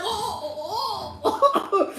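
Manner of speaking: high, laughing oh oh; hammed-up shock; mock shocked gasping. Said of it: "He was like".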